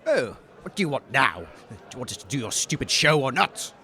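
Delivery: a hint of a low, grumbling cultist; low grumble, fed up; lightly voiced low grumpy cultist. Annoyed cultist voice.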